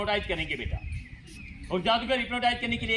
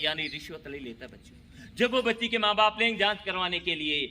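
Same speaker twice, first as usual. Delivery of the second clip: ordinary talk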